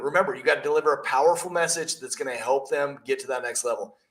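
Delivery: ordinary delivery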